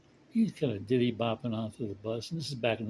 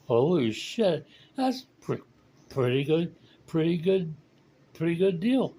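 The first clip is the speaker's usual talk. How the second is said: louder, higher, rising amazement; a stunned drunk repeating himself in awe; amazed drunk; 6 semitones higher, 5 dB louder than usual. Acted. Drunk amazed at pickup success.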